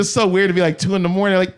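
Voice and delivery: ordinary delivery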